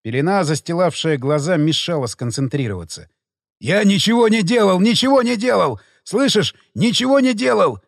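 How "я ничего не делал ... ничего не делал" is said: high, panicked pleading shriek. Acted. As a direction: squeal it in terror. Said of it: "верещал мародёр".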